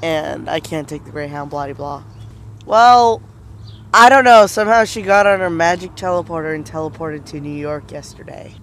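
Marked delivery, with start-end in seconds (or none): none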